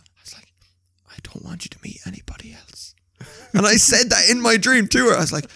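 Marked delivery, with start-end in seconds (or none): 1.1-2.9 faint whispered last wish; dying man whispering to wife; deathbed whisper. Retold or imitated. Deathbed request.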